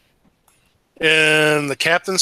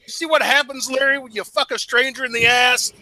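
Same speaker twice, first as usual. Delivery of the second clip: higher gloating jeer; mocking taunt; 7 semitones higher than usual. Player mocks Larry before shooting him, gloating. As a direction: rub it in with a sneer. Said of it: "I'm gonna say".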